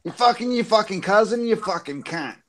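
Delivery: high-pitched mock insult; imitated drunken comeback. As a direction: mimic a neighbor's row in a high voice. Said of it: "That was his comeback".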